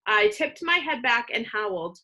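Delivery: ordinary delivery, nothing marked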